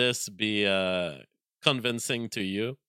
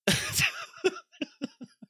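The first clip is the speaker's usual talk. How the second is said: high, giddy real laugh; delighted laugh at himself. Real, not acted. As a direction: let a laugh escape. Laughs about his batch file.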